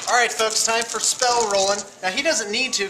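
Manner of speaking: excited host announcement. Acted. Addresses viewers as host.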